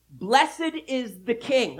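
high, loud, slow proclamation; joyful praise. A narrator's reading. Crowd of disciples praising God, joyfully loud. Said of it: "joyfully to praise God in loud voices".

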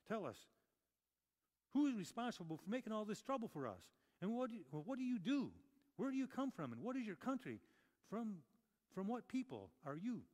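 urgent questions. Acted. Sailors questioning Jonah in storm.

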